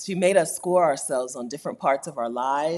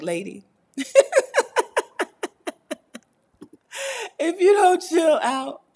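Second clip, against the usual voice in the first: high, slow mock scolding through a laugh; high drawn-out playful scold; mock exasperated lady; 13 semitones higher, 4 dB louder than usual. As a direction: stretch it out, half laughing. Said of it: "I'm like".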